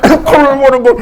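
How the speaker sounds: laughing. Real, not acted. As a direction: laugh your way into the line. Laughing at the memory.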